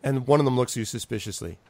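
usual speaking voice

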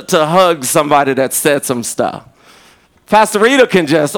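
normal talking voice

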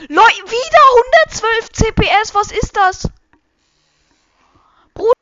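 high, loud disbelieving shrieks; shrieking jackpot winner. Real, not acted. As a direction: scream like you hit the jackpot. Shocked at record clicks.

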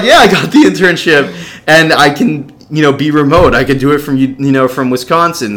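high, loud happy announcement; jubilant. Retold or imitated. Boyfriend tells girlfriend his news, loud and jubilant. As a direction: retell the good news bursting with excitement.

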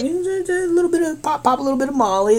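squeaky-high imitation of self-persuasion; very high, playful rationalizing voice; mock coaxing. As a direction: coax in a tiny high voice. Acts escalating self-persuasion.

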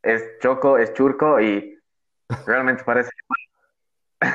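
ordinary delivery

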